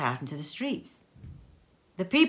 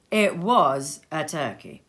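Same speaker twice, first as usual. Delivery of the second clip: ordinary delivery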